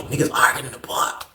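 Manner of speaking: hushed whisper. Retold or imitated. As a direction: whisper it like gossip. Hearing arguing in the pod.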